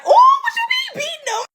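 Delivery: very high, loud nosy squeal; gossiping crowd. Retold or imitated. New York crowd overhearing the abuse claim, high nosy squeal.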